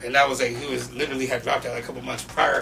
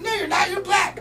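put-on kid voice. Kids voiced in story.

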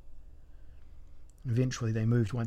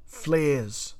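raised, higher narrated shout; shouted command; 4 semitones higher, 4 dB louder than usual. A narrator's reading. Elric calls for flares in the dark cavern, shouting. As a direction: call it out as an order. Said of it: "shouted Elric".

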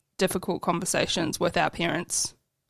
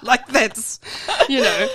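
laughing out the words, fast; 5 semitones higher, 4 dB louder than usual. Real, not acted. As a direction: chuckle through the joke. Laughs at own joke.

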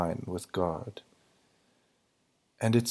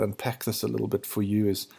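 normal talking voice

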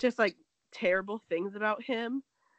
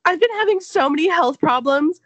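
mock whine; 8 semitones higher, 14 dB louder than usual. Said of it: "she was like".

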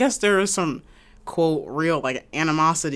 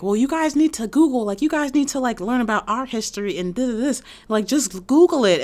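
higher, snooty lecturing mimicry; higher mock snooty voice; mock condescending voice; 8 semitones higher, 5 dB louder than usual. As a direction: talk down like a smug know-it-all. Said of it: "they'd be like".

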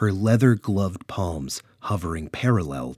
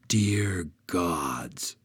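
a shocked, hushed gasp of words; awed whisper; 2 semitones lower, 4 dB quieter than usual. Acted. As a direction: breathe "Dear gods" in a hushed whisper. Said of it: "Brona cried".

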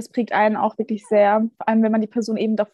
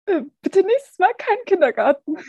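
high, raised scolding tone; stern scolding; 8 semitones higher, 4 dB louder than usual. Retold or imitated. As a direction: scold them like a strict teacher. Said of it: "was du uns als Feedback gegeben hattest".

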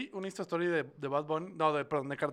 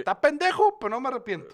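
mock Belinda voice; 9 semitones higher, 9 dB louder than usual. Mocking Belinda's statement.

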